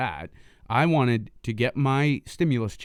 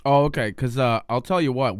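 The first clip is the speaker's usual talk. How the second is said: normal talking voice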